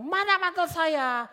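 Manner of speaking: high, loud, slow demand; imitated angry customer demanding. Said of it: "udah marah gitu".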